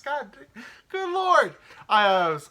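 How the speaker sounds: high, loud shocked yelp; mortified outburst. Real, not acted. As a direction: shriek in embarrassment.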